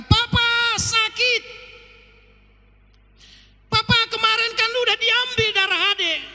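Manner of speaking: put-on a small child begging papa to make it stop; pleading child. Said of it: "Apa yang dia katakan?".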